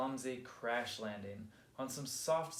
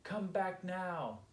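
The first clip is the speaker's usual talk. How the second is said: high, slow call with little extra volume; drawn-out, mildly urgent call to return; 6 semitones higher, slower than usual. A narrator's reading. Molly orders young Marty back, calling out. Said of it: "shouted Molly".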